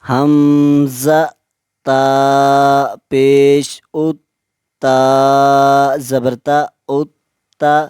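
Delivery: each letter drawn out and even; slow qaida spelling. Shown as a demonstration. Teacher spells slowly, pausing for students to repeat. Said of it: "मैं जब एक हर्फ को पढ़ लूँगा".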